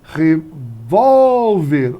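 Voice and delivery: VÓL in revólver held very long and loud; revólver called slowly, swelling on VÓL; stretched stressed syllable. Shown as a demonstration. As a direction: draw out the middle of revólver. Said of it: "Paroxítona é quando tem a penúltima".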